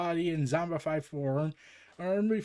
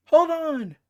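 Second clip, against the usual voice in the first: high loud urgent command; urgent call; 13 semitones higher, 10 dB louder than usual. Acted. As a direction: shout it sharp and high.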